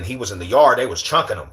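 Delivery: normal talking voice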